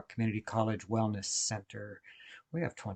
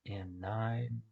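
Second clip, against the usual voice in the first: guided meditation. Acted. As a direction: guide listeners gently through each breath, unhurried. Leads a guided breathing meditation.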